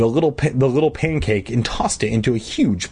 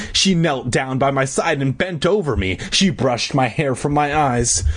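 ordinary delivery

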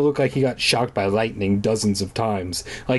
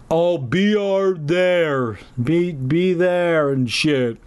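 slow, higher, lumbering fat man voice; fat guy voice; 4 semitones higher, much slower than usual. Pretend. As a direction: drag every word out heavily. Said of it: "'Cause apparently that's how fat people talk".

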